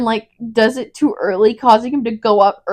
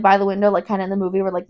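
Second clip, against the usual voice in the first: normal talking voice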